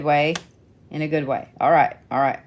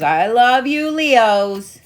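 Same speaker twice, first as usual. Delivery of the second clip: gushing; 5 semitones higher, 6 dB louder, much slower than usual. Real, not acted. Gushing at Leos' eager cards.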